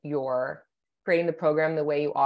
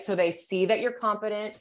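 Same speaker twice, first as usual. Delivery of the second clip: usual speaking voice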